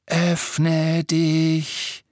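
a sibilant, snarling incantation; hissing command. A narrator's reading. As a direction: hiss it like a serpent's spell. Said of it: "wie ein Zischen und ein Fauchen".

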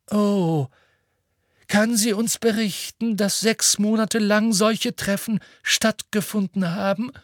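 put-on high-pitched old headmaster voice; Dumbledore, mildly querying. Dumbledore questions what the witness can report, mildly querying. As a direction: lift it high in a polite question.

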